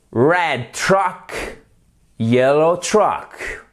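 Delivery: "Red truck, yellow truck" drawn out slowly; slow R drill. Shown as a demonstration. Teacher drills the R for learners, slow. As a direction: roll through the R of "truck" slowly. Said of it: "C'est pour vous faire travailler le R".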